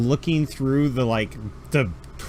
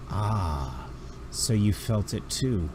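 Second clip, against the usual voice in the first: low menacing villain; 5 semitones lower, 6 dB quieter, slower than usual. Acted. GM voices Inquisitor.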